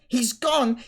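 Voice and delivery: high, loud frantic exclamation. A narrator's reading. Harry learning Dumbledore has left, frantic. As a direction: cry it out in alarm. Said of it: "said Harry frantically".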